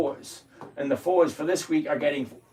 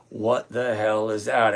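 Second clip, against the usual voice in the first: ordinary talk, nothing marked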